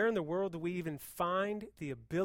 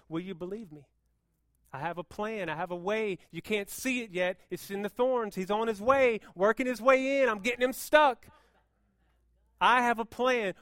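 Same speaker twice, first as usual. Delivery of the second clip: from calm to loud, higher; God's voice building; 5 semitones higher, 9 dB louder than usual. Retold or imitated. God reassuring the church, building from calm to loud. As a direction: let God's promise swell louder. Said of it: "He says".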